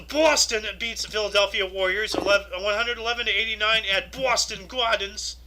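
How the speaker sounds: hammed-up Boston accent on BOSTON; showy Boston pronunciation; put-on Boston accent. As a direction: lean into a mock Boston accent for the sports record. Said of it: "I have to say Boston like that".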